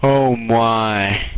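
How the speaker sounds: normal talking voice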